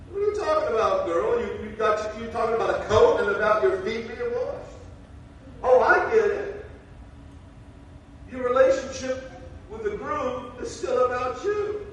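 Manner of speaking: high, loud, mock disbelief; incredulous scolding. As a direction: call her out, what are you talking about. Preacher scolding the bride.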